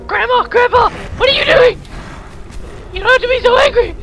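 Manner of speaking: high, loud panicked yelling; frantic high yell of protest; panicked shouting. Real, not acted. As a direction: shout in real alarm as they attack. Undead grandparents attack.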